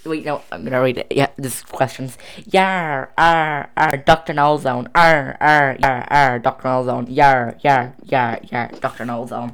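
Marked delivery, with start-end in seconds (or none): 2.4-9.5 loud pirate yars and arrs. Acted. Quizmaster reading how to greet a pirate, loud pirate talk. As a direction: chant it out like a hearty pirate. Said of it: "to a pirate".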